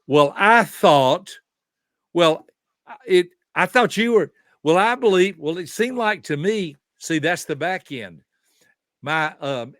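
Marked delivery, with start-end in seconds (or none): 0.1-6.8 louder, higher mimicry of someone backpedalling; imitating a defensive objection; mock protesting excuses. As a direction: act out stammered after-the-fact excuses, pitched up. Mimics excuses made after a misunderstanding.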